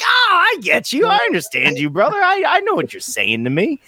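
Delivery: high-pitched, gushing relief; excited recognition. Acted. American meeting a Brit in the wild, excited recognition. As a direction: burst out high, delighted to understand. Greets a British person, finally understood.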